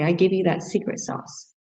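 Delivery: usual speaking voice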